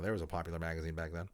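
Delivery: ordinary delivery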